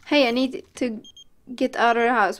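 ordinary talk

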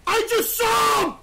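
high, loud, slowed yell of alarm; sudden panicked shout; startled shout. Real, not acted. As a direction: yell it out like you just saw someone. Spots a figure, yells in alarm.

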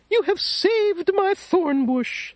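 high delighted thank-you; grateful old lady. Acted.

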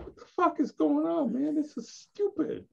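high-pitched, incredulous retelling; acted-out exasperation, pitched high; exasperated disbelief. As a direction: voice shoots way up in baffled protest. Retelling his disbelief at producer's rule.

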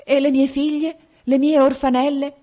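frightened daughter begging her father, in tears; sobbing plea. A narrator's reading. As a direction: plead through sobs for her little girls. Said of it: "ella addusse tra i singhiozzi".

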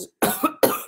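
rough cough; sick narrator coughing after a question. Acted. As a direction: cough through it. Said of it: "I asked before coughing again".

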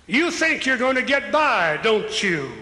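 ordinary talk

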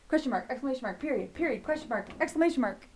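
quick-fire drill with no gaps; speeding up as a chanting game; really fast. Shown as a demonstration. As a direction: rattle off the terms one after another. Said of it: "Keep going really fast".

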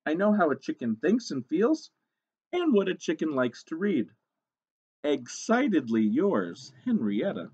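lightly voiced eager chicken letter-writer; slightly hammed-up hopeful author; hopeful hen author. Reads the hen's letter.

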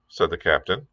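usual speaking voice

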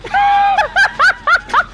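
squealing burst of laughter; very high laughter. Real, not acted. Laughs at what happened.

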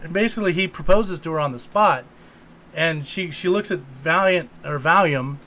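ordinary talk, nothing marked

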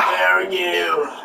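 stretched-out cowboy twang; slow cowboy drawl. Pretend. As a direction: drag it out like a cowboy. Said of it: "Why are you talking like a cowboy?".

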